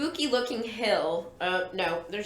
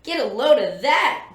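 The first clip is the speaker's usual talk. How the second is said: a louder, mildly excited exclamation; crying out; 2 semitones higher, 6 dB louder than usual. A narrator's reading. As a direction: read a character suddenly calling out, slightly hammed. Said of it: "Brad suddenly cries out".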